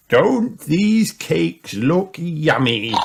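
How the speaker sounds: high, slow playful grandpa; a jolly grandpa, slowly. A narrator's reading. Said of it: "said Grandpa Pig".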